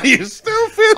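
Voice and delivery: very high mock-indignant squeal; mimicked old lady. Co-host echoing the old lady, high-pitched mock scolding.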